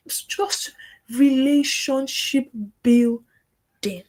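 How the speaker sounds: slow breathy whisper; whispered secret advice. Real, not acted. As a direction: lean in and whisper it. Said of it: "whispered point".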